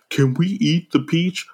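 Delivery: put-on slow, lightly childlike ask; a small hopeful kid; little kid voice. A kid asking James about the peach, lightly childlike. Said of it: "comes ask James a question".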